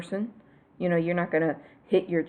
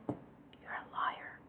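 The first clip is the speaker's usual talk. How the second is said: whisper; 16 dB quieter, much slower than usual. Real, not acted. Teasing accusation to viewers.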